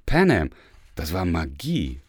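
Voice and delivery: usual speaking voice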